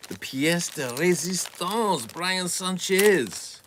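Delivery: put-on slow, high, grandly drawn-out French accent. For Canadian viewers, a mock-grand French accent announcement. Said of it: "That French accent is provided".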